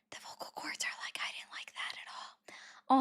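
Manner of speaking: barely-there, voiceless whisper; whispered complaint. Acted. Streamer voicing strained vocal cords' complaint, whispered.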